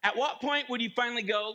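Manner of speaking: ordinary delivery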